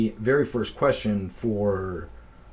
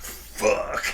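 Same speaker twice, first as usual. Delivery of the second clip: muttered curse. Retold or imitated. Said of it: "He goes".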